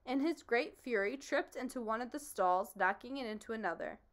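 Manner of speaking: plain reading voice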